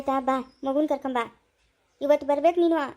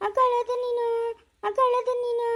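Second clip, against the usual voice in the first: put-on higher sing-song cooing to the baby; baby talk; 5 semitones higher than usual. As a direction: coo it like you're talking to an infant. Mother cooing to her baby.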